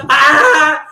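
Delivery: high, piercing wail; mock tantrum scream; imitated loud scream. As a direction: screech at full volume, mimicking her. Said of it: "screaming so fucking loud".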